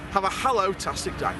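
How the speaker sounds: ordinary delivery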